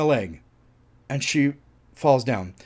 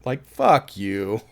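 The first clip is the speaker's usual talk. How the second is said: normal talking voice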